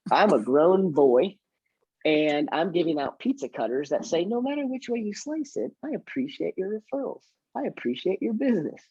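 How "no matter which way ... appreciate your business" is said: mock-cheesy high sing-song read; lightly voiced cheesy salesman, higher and softer; playing up the corny slogan, high and soft; corny tagline voice. Quoting corny pizza cutter tagline.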